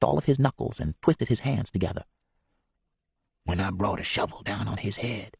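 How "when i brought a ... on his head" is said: hushed confession, quiet; barely above a breath, confessing; whispered. Acted. Man confessing a killing to boys, whispered. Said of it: "he whispered".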